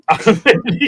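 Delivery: laughing through the words; breaking into a real laugh mid-phrase. Real, not acted. Laughs at his misreading.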